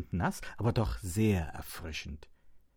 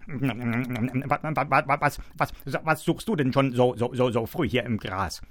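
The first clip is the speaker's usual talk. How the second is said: mumbling hare; 2 semitones higher, 7 dB louder than usual. A narrator's reading. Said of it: "nuschelte der Hase".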